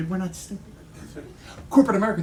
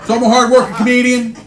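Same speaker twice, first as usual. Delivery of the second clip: ordinary talk, nothing marked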